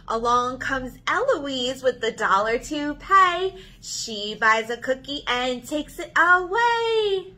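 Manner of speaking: bouncy sing-song counting rhyme; counting rhyme for kids. Acted. Librarian reading a cookie counting rhyme to kids, sing-song. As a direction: read it like story time for little ones.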